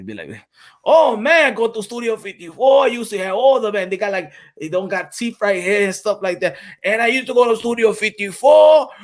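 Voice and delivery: put-on loud, excited Puerto Rican old-timer bragging. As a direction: boast about the old days, loudly. Loud Puerto Rican character bit.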